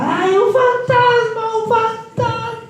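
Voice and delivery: very high, slow, shrieking cry of fright; terrified scream. Retold or imitated. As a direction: shriek it like sailors seeing a ghost. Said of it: "empezaron a gritar". (grito aterrado, muy agudo y lento)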